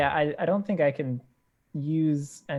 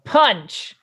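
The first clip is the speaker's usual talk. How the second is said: loud, high one-word yell; battle yell; 6 semitones higher, 11 dB louder than usual. Acted. Fighter with nothing cool to say just yells, loud. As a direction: yell it out as you throw the blow. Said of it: "so I just yell".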